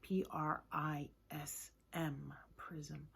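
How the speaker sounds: soft, slow letter by letter, then the word; soft teacher spelling for children. Shown as a demonstration. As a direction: spell it gently and slowly. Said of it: "using a prism".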